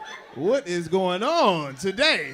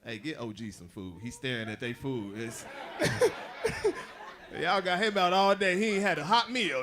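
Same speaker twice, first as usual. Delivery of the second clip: ordinary delivery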